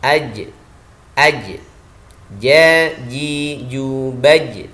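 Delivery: "Aj, ja, ji, ju, baj" as separate drilled syllables; sound held back. Shown as a demonstration. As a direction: stop the sound firmly on each j from "Aj". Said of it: "suara tertahan". ("Aj, baj" dengan suara tertahan)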